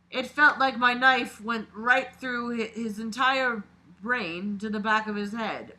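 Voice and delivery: dramatic read-aloud of a creepy story. A narrator's reading. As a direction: narrate it like a confession being read out. Reads the killer's story aloud.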